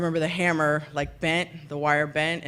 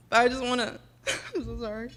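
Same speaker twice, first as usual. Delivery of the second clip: tearful, choked-up apology; fighting back real tears, voice breaking; real crying; 4 semitones higher, 4 dB quieter than usual. Real, not acted. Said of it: "God, I'm gonna get emotional".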